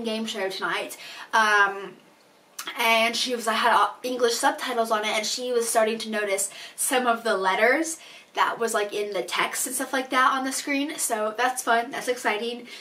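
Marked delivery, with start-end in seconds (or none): none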